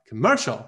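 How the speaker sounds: jerk the pitch up inside "commercial"; misplaced stress. Shown as a demonstration. Teacher mimics students who can't find stress, lifted "commercial". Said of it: "哎，不对".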